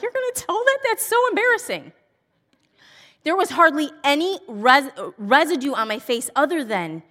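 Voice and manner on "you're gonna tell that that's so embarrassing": high-pitched mortified daughter; embarrassed daughter. Retold or imitated. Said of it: "My daughter was like".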